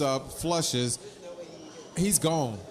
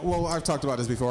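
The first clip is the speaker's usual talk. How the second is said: usual speaking voice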